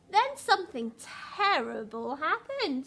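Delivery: put-on lively read-aloud for young children; storybook reading voice; story narration. A narrator's reading. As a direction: tell the story to a class, bright and rhythmic. Reads picture book aloud.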